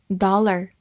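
American "dollar", open ah and final r; American accent. Shown as a demonstration. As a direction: say "dollar" the American way, DAH-ler. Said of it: "美式发音".

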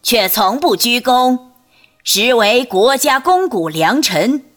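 high, loud, drawn-out court proclamation; eunuch's thin voice. A narrator's reading. Eunuch Zhao reads the imperial edict to the court, thin high voice. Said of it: "赵公公那尖细的嗓子继续念叨".

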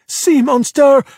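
high, loud cry of alarm; scream. A narrator's reading. Luca cuts in, pointing at Alberto, screaming. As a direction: shriek it as an accusation. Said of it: "Luca screamed".

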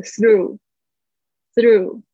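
slow one-syllable "through", no O after "th"; contrasting "through". Shown as a demonstration. Teacher contrasts a lookalike word, slow careful "through". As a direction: draw out "through" as a single "throo". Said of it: "那个就是没有O这个字".

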